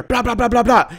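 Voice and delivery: loud, high rapid-fire vocal bangs; hard volley of shouted syllables; imitated gunshots. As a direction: mimic emptying a gun. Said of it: "and you hear".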